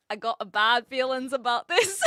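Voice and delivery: mock Scottish movie quote; put-on Scottish, about to laugh; Scottish accent. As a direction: quote it in an amateur Scots accent. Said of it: "my best attempt at a Scottish accent".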